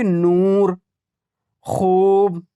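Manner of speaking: नूर and खूब with very long oo; drawn-out vowels. Shown as a demonstration. Teacher contrasts them with zor and shor, stretched नूर, खूब. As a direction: hold the oo in नूर, then in खूब. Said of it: "खींच कर नहीं पढ़ा जा रहा".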